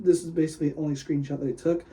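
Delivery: usual speaking voice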